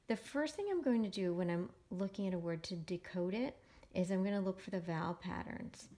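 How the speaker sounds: usual speaking voice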